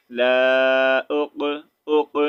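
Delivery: اق with the qaf bounced like an echo, slow and loud; qalqala echo. Shown as a demonstration. Teacher demonstrates qalqala on اق, slow and loud. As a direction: let the qaf in اق rebound. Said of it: "ایکو sound کی طرح".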